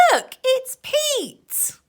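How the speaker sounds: high, slow excited captain's call. Acted. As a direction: spot Pete in the sea with glee. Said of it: "the captain called out".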